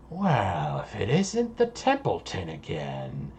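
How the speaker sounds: low, quiet villain voice; arriving villain. Acted. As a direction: greet an old enemy softly and low. Game master voices a figure.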